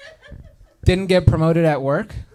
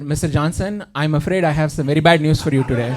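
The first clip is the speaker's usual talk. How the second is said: put-on Indian-accented doctor on the phone. Doctor phoning a patient with bad news, Indian accent. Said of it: "Indian people with, with our accents".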